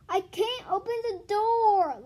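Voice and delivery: strained and breathless, slow; gasping. A narrator's reading. Louis at the door, gasping under the package. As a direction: gasp it out under the heavy load. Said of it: "Louis gasped".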